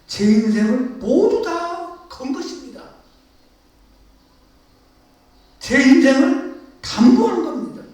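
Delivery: loud, fervent confession read as a prayer; impassioned prayer. Acted. Preacher reads an anonymous believer's confession, fervent and loud. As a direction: pour it out to God at full force.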